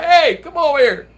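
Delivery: loud, high call across the street; loud retold holler; retold yell. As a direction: yell to get the guy over. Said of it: "I yelled at the guy".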